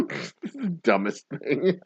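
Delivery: normal talking voice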